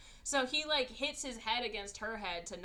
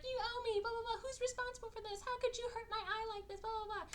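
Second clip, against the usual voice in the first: very high, pestering imitation; mock nagging; 13 semitones higher, 3 dB quieter, faster than usual. Woman blaming her rescuer for her eye, mock whiny nagging. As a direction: mimic an annoying complainer, pitched way up.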